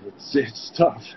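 voice breaking. Real, not acted. Father recalling his son's death.

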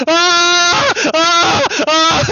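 laughing shriek. Real, not acted. Crying with laughter at clip.

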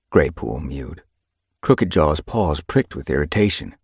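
usual speaking voice

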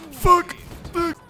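high, loud one-word curse; alarmed shout. Real, not acted. Player after a grenade throw, alarmed shout. Throw goes wrong in game.

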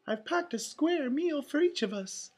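high falsetto pleading; Minnie. A narrator's reading. Mouse pleading with the duck to come, high coaxing voice. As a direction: coax in a sweet, high cartoon mouse voice. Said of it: "Minnie pleaded".

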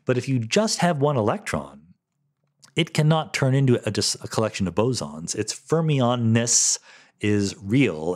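normal talking voice

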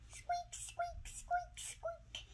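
tiny, very high squeaking voice; baby bird squeak. Acted. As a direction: squeak like a chick inside its shell. Egg cracking, baby squeaks.

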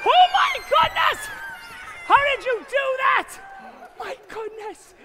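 high, loud astonished shout; hammed-up amazement. Magician praises the child helper, amazed shout. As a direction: overplay the wonder for the child.

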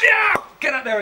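high, loud cheer of encouragement; cheering yeah. Acted. Egging on his friend to go for it, loud cheer. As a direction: whoop him on.

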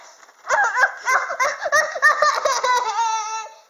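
loud high victorious cackle; triumphant cackle. Acted. Triumphant laugh.

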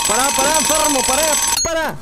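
loud, high panicked yells of "wait"; startled yelling. Real, not acted. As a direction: shout in real panic after a jump scare. Jump scare in horror game.

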